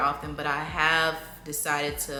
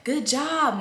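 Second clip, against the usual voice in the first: higher cheerful "Good job"; praise voice; 8 semitones higher, 4 dB louder than usual. Shown as a demonstration. Mom's usual praise to toddler, high cheerful. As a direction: chirp it up for the kid. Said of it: "always saying".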